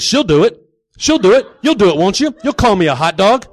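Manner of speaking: loud, high, pushy demand; frantic, badgering shout; heated insisting. Turns demand on another person.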